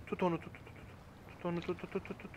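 very soft, repeated urging; hushed urging. Real, not acted. Player telling partner to hold on, hushed and urgent. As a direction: urge under your breath, barely voiced.

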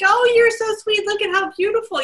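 put-on high, gushing baby talk. Owner gushing at a sassy human in high baby talk. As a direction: coo sweetly like to a baby. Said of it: "throwing me attitude".

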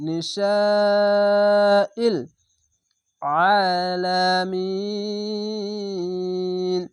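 very slow chanted verse with long held vowels; melodic Quran recitation. Shown as a demonstration. Teacher recites the full verse for students, slow melodic chant. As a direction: sing it out, stretching every long vowel. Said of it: "চার আলিফ টেনে পড়বেন".

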